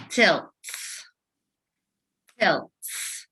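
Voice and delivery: "Tilts" said as one clapped beat; clapped syllable. Shown as a demonstration. Teacher clapping out "Tilts" with class. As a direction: clap out "Tilts" as a single beat. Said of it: "Let's clap it out".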